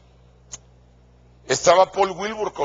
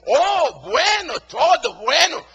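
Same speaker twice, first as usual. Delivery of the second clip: high, loud mock enthusiasm, nearly shouting; 12 semitones higher, 5 dB louder, much slower than usual. Singer replying to the excited pastor, high sarcastic shouting. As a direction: gush it back with exaggerated sarcasm. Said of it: "y sarcásticamente me dijo".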